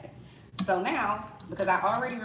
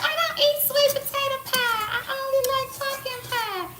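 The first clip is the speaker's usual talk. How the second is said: much higher prissy voice, slowing down; mocking picky eater; 4 dB louder, slower than usual. Mimicking pie snobs in a high prissy voice. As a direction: whine it like a fussy guest. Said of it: "the people that be like".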